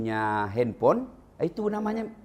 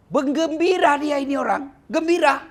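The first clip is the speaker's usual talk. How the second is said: joyful exclamation; 13 semitones higher, 7 dB louder than usual. Real, not acted. Narrator exclaims joy.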